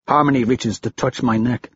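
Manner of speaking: plain reading voice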